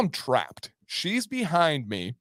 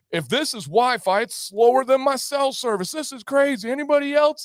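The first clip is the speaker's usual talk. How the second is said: high, carrying complaint to the whole cabin; mock loud complaining; 8 semitones higher than usual. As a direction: imitate a passenger fishing for agreement. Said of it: "he starts talking real loud".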